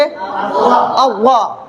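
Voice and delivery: अव्वा as a heavy doubled emphatic consonant with rounded "aw"; thick heavy articulation. Shown as a demonstration. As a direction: fill the mouth on अव्वा, dark and rounded. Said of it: "मोटा करके पढ़ेंगे".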